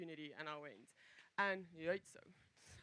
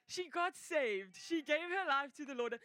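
higher, louder, thrilled proclamation; excited triumph; 7 semitones higher, 6 dB louder, slower than usual. Real, not acted. Testifier tells listeners she got saved, excited triumph. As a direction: announce it with real elation.

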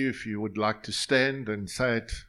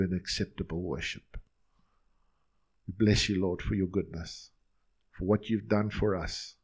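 closing prayer. Real, not acted. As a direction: pray over the people, then close gently. Closing prayer over congregation.

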